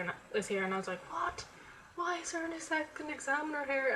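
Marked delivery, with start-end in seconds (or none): 1.1-3.9 high, startled retelling of her own shock; shocked disbelief. Retold or imitated. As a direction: squeak it out as if caught off guard. Retold shock at second examiner.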